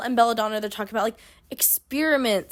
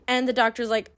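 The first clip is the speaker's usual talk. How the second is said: ordinary delivery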